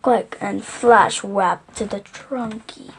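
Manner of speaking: ordinary delivery, nothing marked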